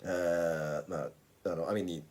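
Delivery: normal talking voice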